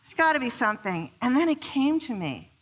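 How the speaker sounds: usual speaking voice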